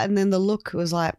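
ordinary talk, nothing marked

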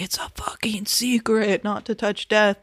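put-on whispered old lady warning; imitating a whispering old woman; mock secretive whisper. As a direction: hush it like a secret. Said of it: "that lady's like".

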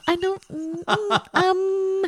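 put-on high, stammering Los Angeles Valley girl accent; a whiny, stuck Valley girl accent. Her Valley girl accent, stalling at the hardware store. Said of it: "that L A, like, Santa Monica accent".

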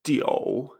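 low "Jiu" with an o in the middle; third tone. Shown as a demonstration. Teacher repeats number nine for learners, third tone. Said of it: "en tercer tono".

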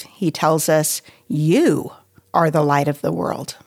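normal talking voice

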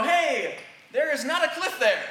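loud, high mock warning call; warning shout. A preacher acts out shouting a blunt warning to a friend. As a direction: yell it out like a warning to someone near a cliff. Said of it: "if I am like".

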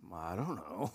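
a low, mumbled, helpless husband's reply; meek husband. Retold or imitated. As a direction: shrug it off like a cornered husband. Husband shrugging off wife's question.

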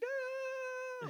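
a very high mock scream; shriek. The daughter surfacing as her father dies, a very high mock scream. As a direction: shriek like the princess seeing her father die.

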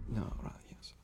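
whispered, giving in to the taunt; defeated whisper. Acted. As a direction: barely breathe the surrender. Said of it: "And you're like".

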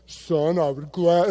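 attempted low, slow fatherly voice; deep-voiced pastor dad. Pastor dad answers the intern, putting on a deep voice. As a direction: try a deep intimidating dad voice. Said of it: "he had one of those deep voices".

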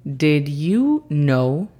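very slow careful English demo; teacher model, very slow; slow model phrase. Shown as a demonstration. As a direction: stretch each word clearly. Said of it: "Y esa frase es".

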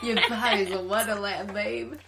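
laughing, lingering mock-seductive accent; Game of Thrones accent. Echoing her friend's imitation, laughing in a weird accent. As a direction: ham up a fantasy lover's accent. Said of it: "a weird accent from Game of Thrones".

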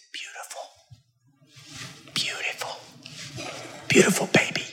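hushed whisper of admiration. Retold or imitated. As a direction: whisper it so nobody hears. Visitors admiring the hidden baby.

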